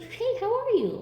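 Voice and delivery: high, bright hey, how are you; cheery classmate greeting. Retold or imitated. Classmate greeting her cheerfully, not seeing her cry. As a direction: greet her like nothing's wrong.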